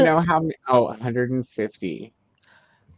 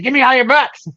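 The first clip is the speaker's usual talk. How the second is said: loud, high mock demand; greedy demand; 10 semitones higher, 9 dB louder than usual. Retelling a Barnes and Noble trip, mock greedy loud demand. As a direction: play it as a hammed-up greedy shopper. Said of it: "I was like".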